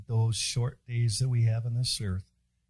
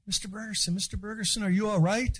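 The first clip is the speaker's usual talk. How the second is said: put-on higher, anxious kid-like voice; imitating worried schoolkids; 9 semitones higher than usual. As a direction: call out to the teacher like a worried child. Worried kids asking the teacher.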